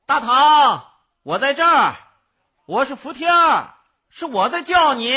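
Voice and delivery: 高亢响亮地呼唤应答; 朝远处放声表明自己; 继续高喊. A narrator's reading. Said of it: "继续喊道". (扯开嗓子一句句地喊)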